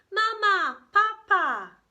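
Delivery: high, loud joyful cry; shouting for parents. A narrator's reading. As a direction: call out to mama and papa. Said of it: "he shouted".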